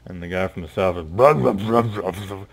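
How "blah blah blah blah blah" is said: mumbled gibberish from the boar; weird mumbling. Acted. Boar leader mumbles at the group, garbled. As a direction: garble it like nonsense. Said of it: "really weird mumbling".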